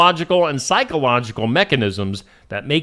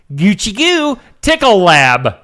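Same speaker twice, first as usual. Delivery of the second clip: stretched out, higher and louder, goofy; silly drawn-out name; 5 semitones higher, 5 dB louder than usual. Pretend. Host reading news, hamming up the lab name. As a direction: savor the silly name slowly.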